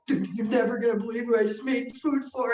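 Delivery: excited retelling. Retold or imitated. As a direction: gush it fast, as if on the phone. Retold excited phone call to friend.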